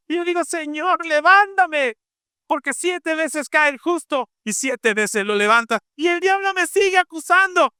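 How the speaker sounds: high, driving preacher's voice; fervent preaching. Acted. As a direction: preach it with rising fire. Preaching at full force. (predicación con fervor)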